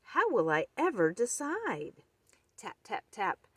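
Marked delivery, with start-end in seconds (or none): 0.0-2.0 squeaky mouse voice. Acted. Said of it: "squeaked Mouse".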